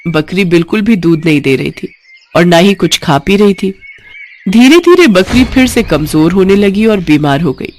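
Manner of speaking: ordinary delivery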